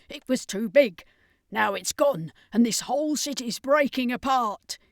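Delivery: lightly voiced alarmed ally; hurried, worried explaining; urgent Carleen. A narrator's reading. Carleen explaining the danger to the circus, urgent.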